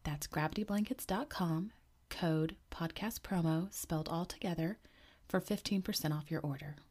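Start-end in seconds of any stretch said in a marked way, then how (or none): none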